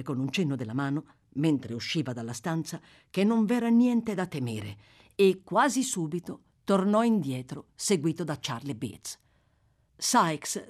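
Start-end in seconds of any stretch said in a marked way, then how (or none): none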